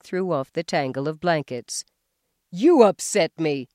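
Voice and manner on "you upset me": loud accusing yell; husband yelling an accusation. A narrator's reading. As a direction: throw it back at her. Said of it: "he yelled".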